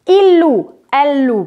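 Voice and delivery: "Il loue, elle loue" plural said as "lou", with no E, N or T heard; teacher models the plural "loue" ending on OU; silent ending. Shown as a demonstration. Teacher shows the class the plural "loue" with its ending silent. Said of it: "Je ne prononce ni le E, ni le N, ni le T".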